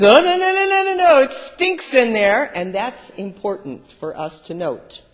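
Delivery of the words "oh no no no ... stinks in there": high, loud horrified objection; crowd protest. Acted. Mourners protesting to Jesus, high loud horror. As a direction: balk at opening the stink.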